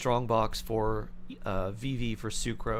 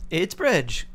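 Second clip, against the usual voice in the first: higher, louder excited announcement; 7 semitones higher, 7 dB louder than usual. Real, not acted. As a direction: proudly proclaim it. Repeats the silly word louder.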